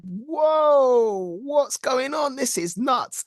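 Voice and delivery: high-pitched astonished "whoa"; amazed. Retold or imitated. Actor recalling his shock at the sales figures, amazed. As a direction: blurt it out stunned, pitched well up.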